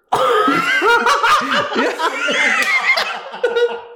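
a long, very high shriek of laughter. Real, not acted. Laughing at her own daydream about moving abroad, shrieking laugh. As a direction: cackle way up high.

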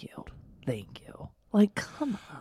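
whispered thank you, soft and hushed; whispered agreement. Real, not acted. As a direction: whisper it as a conspiratorial aside. Agreeing under breath.

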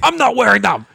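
high, loud bratty mimic; mock whiny protester. Friend mocking an anti-mask protester, high bratty mimic. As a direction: sneer it like a childish protester.

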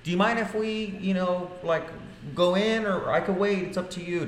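high, overly polite and sweet; mock-polite request to the rude realtor. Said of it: "Just being polite".

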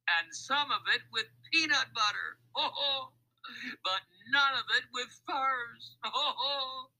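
high, gleeful acted laughter; guffawing. A narrator's reading. Mac mocks the thieves as they drive, guffawing. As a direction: crow the list between loud ho-hos. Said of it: "With Mac's laughter ringing in their ears".

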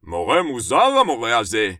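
loud schoolboy outburst; loud exclamation. Acted. Moti to his friend about the teacher, loud exclamation. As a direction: blurt it out loudly to a classmate.

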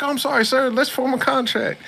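suddenly cowering, high-pitched and apologetic; scared Prince of Darkness. Retold or imitated. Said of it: "he was scared".

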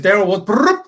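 punchy retelling with a loud mouth-made trill; imitated brrrp noise. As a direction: act out the line, ending in a high trilled brrrp. Imitates a noise inside quoted line.

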